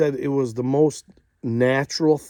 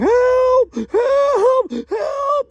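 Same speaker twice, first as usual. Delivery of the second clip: panicked cries for help, much higher and louder; freaking out; 8 dB louder, much slower than usual. Retold or imitated. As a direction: shriek it like the lion is on you. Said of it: "he starts freaking out".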